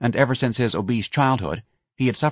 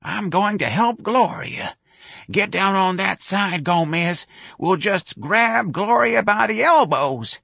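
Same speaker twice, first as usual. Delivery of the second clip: put-on high, slow old-woman voice; dotty old lady giving orders, slowly; Miss Trixie; 12 semitones higher, slower than usual.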